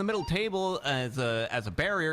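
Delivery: live ringside commentary, running call of every punch; fight play-by-play. Acted. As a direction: call the fight blow by blow like a sportscaster.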